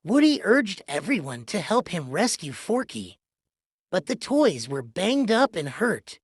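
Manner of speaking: ordinary delivery, nothing marked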